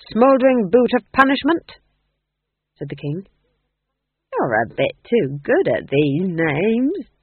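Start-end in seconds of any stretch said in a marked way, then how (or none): 0.1-1.7 louder, higher anxious guess; desperate guess. Acted. Ghost king guessing torture devices for Nanny, desperate and louder. 4.3-7.1 slow, pointed and suspicious; sharp. Acted. Nanny Ogg turning suspicious on the ghost king, sharp and slow. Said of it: "said Nanny sharply".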